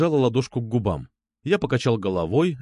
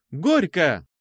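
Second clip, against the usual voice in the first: higher wedding-toast call; exclaimed cheer; 5 semitones higher than usual. A narrator's reading. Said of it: "тотчас воскликнула". (возглас, как на свадьбе)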